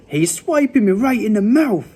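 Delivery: high yell of complaint. A narrator's reading. Woken giant complains of being hit, yelling. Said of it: "yelled the meat dri".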